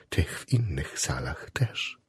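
very soft, low, slow echo; hushed confirmation. A narrator's reading. Chaplain confirms to the patient he visits other wards, hushed. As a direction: repeat it slowly, almost whispering.

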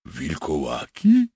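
slow, rising incredulous echo; astonished. Acted. Captured soldier echoes the werewolf idea, astonished. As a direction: stretch it into a disbelieving question. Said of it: "Freder wytrzeszczył oczy".

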